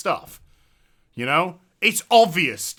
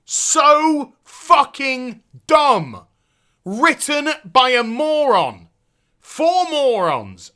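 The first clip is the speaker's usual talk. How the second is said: slow, higher, louder disgust; disgusted rant; 6 semitones higher, 6 dB louder, much slower than usual. Real, not acted. Streamer scorning the game's writers, slow and disgusted. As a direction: drag out your contempt.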